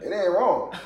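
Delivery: ordinary talk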